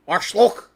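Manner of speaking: "Arschloch" with a German ch; German word. Shown as a demonstration. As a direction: spit out "Arschloch" as proper German. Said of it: "The one that goes".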